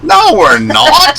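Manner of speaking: high, slow squeaky laughing no; shrill mock denial. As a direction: squeal it out like a Python granny. Mock shrill denial, Monty Python.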